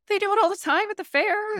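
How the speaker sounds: high sing-song defending herself to dad; high mock retort. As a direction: toss it back in a high, cheeky voice. Arguing with dad over parking.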